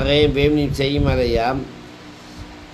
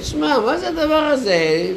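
puzzled man; 11 semitones higher than usual. Retold or imitated.

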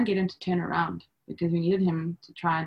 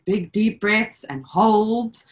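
slow coaching voice; 4 dB louder, much slower than usual. Retold or imitated. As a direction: stretch it out, slow and calm like a birth coach. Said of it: "Charlene said".

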